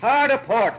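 captain startled by the parrot, loud yelled command. Acted. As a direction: yell it like a startled order at sea. Startled captain yells ship command.

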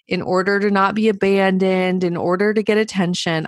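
ordinary talk, nothing marked

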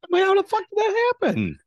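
high, loud squeal through snot; snotty crying. Real, not acted. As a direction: shriek while snot runs down. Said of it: "snot running down your face".

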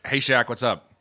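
normal talking voice

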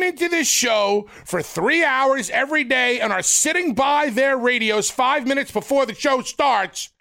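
high, emphatic tirade climbing to a shout; impassioned rant. Real, not acted. Co-host scolding host over losing loyal listeners, impassioned. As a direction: build the outrage to a high cry.

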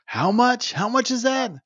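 put-on high, loud cranky old man; grandpa voice. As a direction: squawk it like a grumpy grandpa. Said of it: "I sound like my grandpa".

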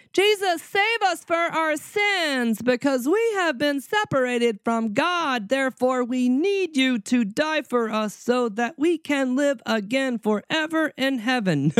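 high, slow, pleading sing-song prayer voice; mock evangelical prayer. Preacher mocking an evangelical prayer, high and slow. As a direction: overdo a pious plea, way up high. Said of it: "They're not saying".

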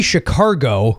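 "Chicago" with an R, as "Shicargo"; dad's Southern pronunciation. Retold or imitated. Quoting his accented dad, "Chicago" as "Shicargo". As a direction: say "Chicago" like "shi-CAR-go". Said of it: "he would say Chicago".

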